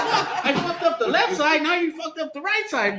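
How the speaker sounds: high-pitched retold dismay; exasperated complaint. Man blaming drunk driver for wrecked car, exasperated. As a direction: act out blaming her, pitch way up. Acted quarrel after the sideswipe.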